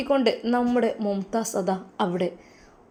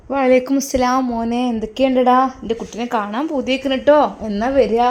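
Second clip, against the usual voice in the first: put-on loud, higher old mother's voice on the phone; mother on the phone to Faisal, loud and higher; affectionate old mother; 4 semitones higher, 7 dB louder than usual. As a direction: greet your son loudly, full of fondness.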